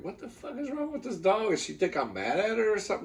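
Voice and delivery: high, soft re-enacted question; retold bafflement. Retelling bafflement at dog.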